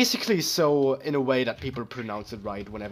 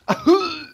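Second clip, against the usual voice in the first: high, loud "ahoo" wail falling off; imitated death cry; 12 semitones higher, 5 dB louder than usual. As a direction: mimic a game death sound, high and loud. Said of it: "The fucking death sound".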